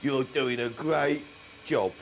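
low, soft, falsely kind; mock sweet. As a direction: lull him with fake praise. Baboon suddenly turns soothing, friendly.

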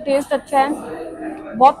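speaking between bites; talking while chewing. Real, not acted. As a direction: mouth partly full. Seen 2: Bit pastry at -5; closed-lip chewing continues into early words.

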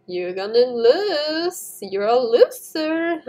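drawn-out teasing, rising into a laugh; gloating taunt. Real, not acted. Player taunting Mister Pickle in the race, gloating taunt. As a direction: rub it in, stretched and gleeful.

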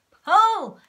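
very loud, high yell. A narrator's reading. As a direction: drive them to pull. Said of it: "yelled Muggle One".

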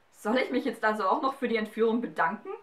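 can't believe what she's hearing; incredulous. A narrator's reading. Galatea asks the giant if she must thank him, incredulous. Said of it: "fragte Galatea fassungslos".

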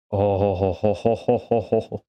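a run of hammy "ho ho ho"s; gloating laugh. Real, not acted. Gamer gloating at the game with mock ho-ho laughter. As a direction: chuckle triumphantly in a mock-villain laugh. Gleeful at reaching Chernobyl.

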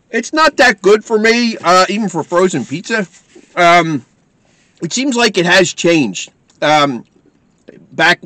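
talking with mouth partly full; eating between words; chewing food while talking. Real, not acted. Seen 0: Holds pizza, chews between phrases, takes bites throughout clip.

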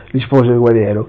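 ordinary delivery

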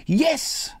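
put-on high, delighted child's yes; brimful of glee. A narrator's reading. Little sister answers her brother, brimful of glee. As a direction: confirm it with bubbling joy. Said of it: "said the child, brimful of glee".

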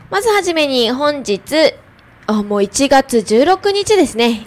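clear, full-voiced radio announcer reading the weather and traffic; ラジオの天気予報読み. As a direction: read the forecast like a community FM host, bright and steady. (ラジオパーソナリティの明るくはっきりした天気・交通情報の読み)

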